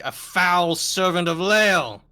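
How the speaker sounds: loud, high, drawn-out accusation; theatrical denunciation. Acted. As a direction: declaim it slowly like a public condemnation. Acting out a denunciation in character.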